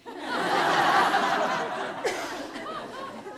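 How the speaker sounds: high, breathy laugh; a real burst of laughter. Real, not acted. Laugh after punchline.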